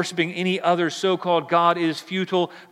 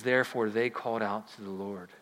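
softer, lower repeat of "Therefore, they called out to the Lord"; quiet reading; 5 semitones lower, 6 dB quieter than usual. Shown as a demonstration. Preacher rereading the verse to the church, hushed. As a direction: say it again hushed and reverent.

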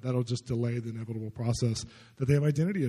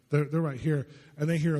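usual speaking voice

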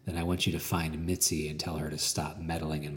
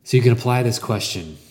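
emphatic preaching; 5 semitones higher, 11 dB louder, faster than usual. Real, not acted.

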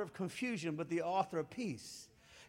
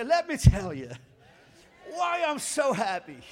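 loud, projected congregational prompt; 4 semitones higher, 9 dB louder than usual. Shown as a demonstration. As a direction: belt it out for everyone to repeat. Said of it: "say it out loud with me".

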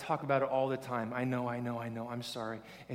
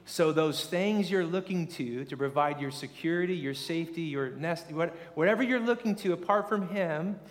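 usual speaking voice

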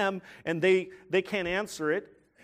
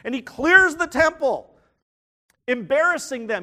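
emphatic preaching; 8 semitones higher, 9 dB louder than usual. Real, not acted. Preacher building to climax.

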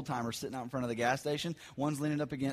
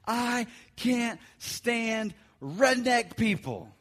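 high, slow and disgusted; stretched-out scornful complaint; exasperated outburst; 8 semitones higher, 4 dB louder, much slower than usual. Retold or imitated. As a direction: blurt it out with disdain. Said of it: "the words fell out of my mouth".

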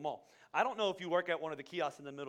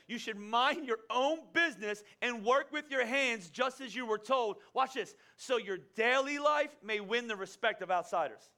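slow, weighty reading of the verse; scripture reading; 5 semitones higher, 4 dB louder, slower than usual. Acted. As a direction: lay each phrase down slowly for the church. Reads Scripture to congregation.

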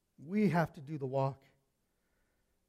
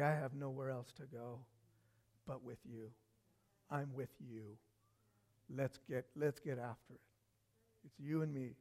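low, soft, halting vow; quiet devotion; 6 semitones lower, 13 dB quieter than usual. Acted. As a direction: pledge yourself quietly, pausing between phrases. Quiet vow of loyalty to Jesus.

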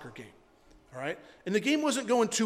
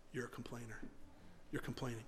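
quiet inner voice; 7 semitones lower, 14 dB quieter than usual. Retold or imitated. Lord's voice to his heart.

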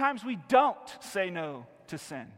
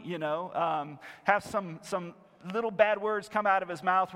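normal talking voice

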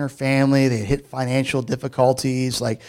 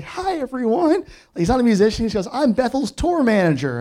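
1 mock tour manager; 7 semitones higher than usual. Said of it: "He's like".

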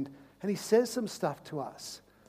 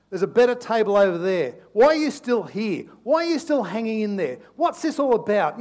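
loud, higher taunting tempter; tempting enemy; 6 semitones higher, 9 dB louder than usual. Acted. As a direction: needle them to leave, loudly. Enemy voiced tempting the listener.

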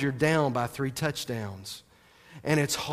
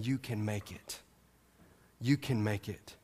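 soft encouragement; 7 semitones lower, 10 dB quieter than usual. Retold or imitated. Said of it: "looking at you saying".

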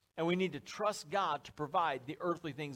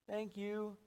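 put-on higher, soft, held-level kid voice; prompted child; 5 semitones higher, 5 dB quieter than usual. Trick-or-treater thanking at the door, dutiful child. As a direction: chant a dutiful little thanks.

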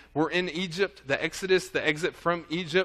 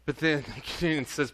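ordinary talk

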